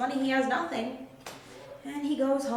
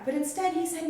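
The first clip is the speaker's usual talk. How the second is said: ordinary talk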